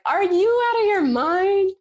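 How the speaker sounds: high, incredulous cry of the husband; the husband is stunned she'd even ask; husband pleading she's lost her mind, voice shooting up; husband's disbelief. Retold or imitated. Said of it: "And he's now like".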